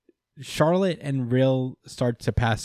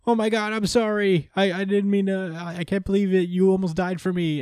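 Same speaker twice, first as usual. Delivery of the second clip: higher, rushed apologetic gush; lightly voiced emotional girl; tearful Laura; 9 semitones higher than usual. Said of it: "she's just like".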